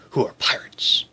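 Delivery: whispered secret aside. Pretend. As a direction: lean in and whisper it. Secretive aside about pirates.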